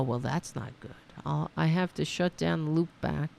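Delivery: usual speaking voice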